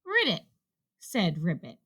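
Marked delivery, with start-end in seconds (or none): 0.0-0.5 high, soft little croak; small frog. A narrator's reading. Ribbit trying to tell Rabbit, small and high. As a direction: pipe up quietly as a frog. Frog pipes up during frantic search.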